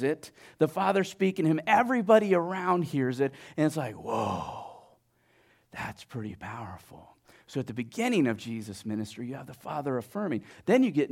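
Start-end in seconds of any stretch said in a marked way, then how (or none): 3.9-7.1 low, soft, awestruck reaction; hushed awe. Acted. Bystander overhearing the Father's words, hushed awe. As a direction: drop down soft, letting it sink in. Imagined onlookers awed by the voice.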